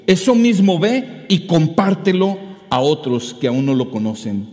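loud, high fervent preaching; preaching at full force. Acted. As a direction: drive it home to the congregation. Preaching climax.

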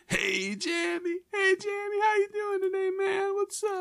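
very high, slow cartoon voice; silly high voice. Shown as a demonstration. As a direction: squeak it like a cartoon. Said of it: "every bad voice I can think of".

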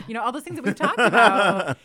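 loud laughing words; real laughter through the words. Real, not acted. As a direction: talk while laughing.

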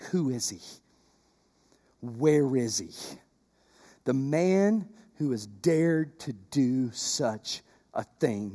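low, slow, soft royal demand; a restrained, dangerous king; king's quiet menace. Retold or imitated. King asking Esther who is behind the plot, quietly menacing. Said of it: "King Xerxes asked Queen Esther".

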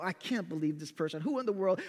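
low-volume mumbled grumble; mumbling to himself. Retold or imitated. Preacher walks away from slide mishap, mumbling to himself. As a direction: grumble under your breath in disbelief. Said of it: "I'm mumbling to myself".

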